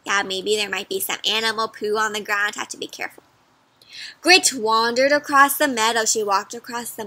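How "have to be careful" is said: soft unvoiced whisper. Reader whispering a warning about poo to the children. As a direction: hush it like a secret warning.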